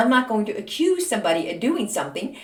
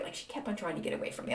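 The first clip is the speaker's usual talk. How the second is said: whispered aside; 3 semitones lower, 13 dB quieter, faster than usual. Real, not acted. Confiding aside to viewers.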